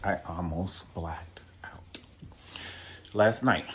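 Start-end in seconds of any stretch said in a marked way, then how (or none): 0.0-2.0 breathless whisper, barely there; stunned whisper. Real, not acted. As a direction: whisper it like you're still in shock. Stunned aside after the read.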